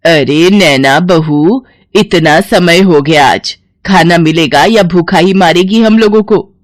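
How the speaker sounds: loud, drawn-out mother-in-law summons; stretched-out, demanding call. Acted. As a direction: call her loudly, dragging out the name. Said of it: "सास नैना को आवाज लगाती है".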